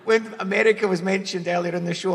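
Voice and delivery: ordinary delivery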